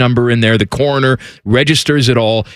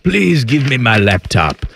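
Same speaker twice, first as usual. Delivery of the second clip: slow, put-on British voice; dying man gone oddly British; measured, quasi-British plea; British accent. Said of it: "I don't know why I'm British".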